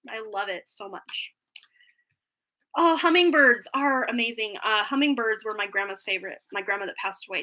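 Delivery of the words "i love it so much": soft whispered aside; whispering. Real, not acted. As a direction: whisper it to yourself. Quiet aside to viewers.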